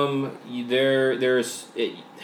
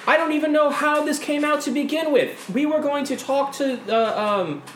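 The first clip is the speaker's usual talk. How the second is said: much higher and louder, pushing over the noise; talking over the crowd; 4 dB louder than usual. Acted. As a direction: raise your voice to be heard over the mob. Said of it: "She's like, ... overspeak".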